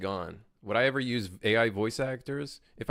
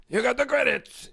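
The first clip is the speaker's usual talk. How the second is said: second try, high and a bit louder; Watto impression; 13 semitones higher, 4 dB louder than usual. Streamer retries Watto for chat, high and louder. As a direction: push the squeaky trader voice again. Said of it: "Can you do a Watto voice?".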